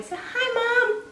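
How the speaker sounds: squeaky high puppy greeting; baby talk. Pretend. Pipe it up high as the puppy. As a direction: breeder voicing the puppy for its new mom, high baby talk.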